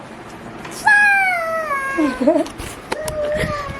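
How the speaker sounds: squealing laugh at the pet; delighted high laugh. Real, not acted. Laughing at her cute parrot, high squealing laugh. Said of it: "बहुत cute लगता है".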